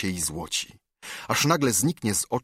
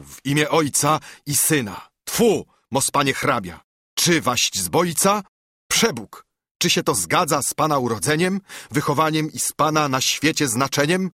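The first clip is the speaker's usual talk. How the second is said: raised, higher-pitched protest; indignant exclamation; 6 semitones higher than usual. A narrator's reading. Judge crossing himself at the count, indignant exclamation. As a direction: exclaim in mild outrage. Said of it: "krzyknął".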